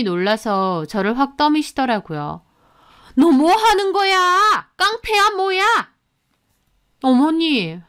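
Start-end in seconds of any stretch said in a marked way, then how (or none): 3.1-5.6 alarmed shout. Acted. Mother-in-law pushes daughter-in-law away.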